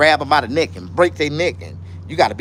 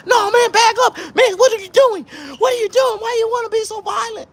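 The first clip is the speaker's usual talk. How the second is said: panicked shouting. Retold or imitated. Coworkers yelling in panic as he flips the table. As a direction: squeal like scared men backing off.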